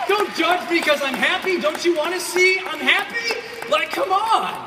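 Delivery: high, loud and slow, shouting his happiness; joyful shouting back. Retold or imitated. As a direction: yell it back at them, overjoyed. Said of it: "And you're like".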